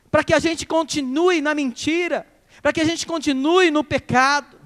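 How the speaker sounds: fervent sermon delivery; preaching. Acted. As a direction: drive the lesson home to the church. Preaching application to congregation.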